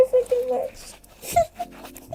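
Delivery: high, slow squeal of self-love; squealy gush. Acted. To viewers, gushing with self-love in a high slow squeal. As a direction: squeal it out, drawn and delighted.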